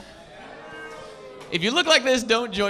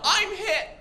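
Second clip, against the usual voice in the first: mock yell; 12 semitones higher than usual. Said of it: "you gotta yell out".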